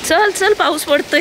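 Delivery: high, loud call to hurry. Real, not acted. Mother calling her child to hurry out of the rain, loud high call.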